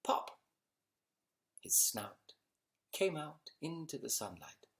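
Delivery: put-on gentle storyteller reading to children; bedtime story reading. Reads bedtime story aloud.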